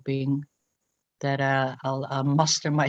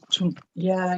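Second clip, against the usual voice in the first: ordinary delivery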